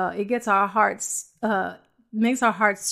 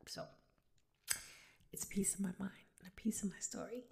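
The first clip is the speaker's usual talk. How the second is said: hushed, quick whisper; 19 dB quieter, faster than usual. Real, not acted. Tearful woman telling her own story to friends, whispered. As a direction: say it under your breath, very softly.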